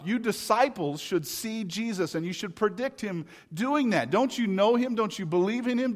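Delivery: loud, high, exasperated scolding; preaching at full force, exasperated; exasperated shout. Acted. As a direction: hammer them with impatient questions. Preacher voices our impatient reaction.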